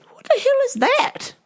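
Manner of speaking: high-pitched startled squeal; mock alarm. Teller hears shrieking in traffic, high mock alarm. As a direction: squeak it out in comic fright. Own alarmed reaction retold.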